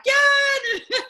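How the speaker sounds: high, loud laughing yeah; excited whoop. Retold or imitated. Cheering along at the event, laughing whoop. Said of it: "I'm like".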